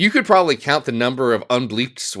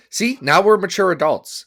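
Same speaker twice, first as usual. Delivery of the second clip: normal talking voice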